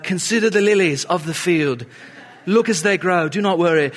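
mock movie-Jesus British accent. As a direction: put on a stagey British accent. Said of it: "he speaks with a British accent".

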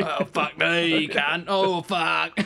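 drawn-out Aussie cursing; playful Australian impression; mock Australian accent. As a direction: put on an Australian twang. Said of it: "Aussie, Aussie words".

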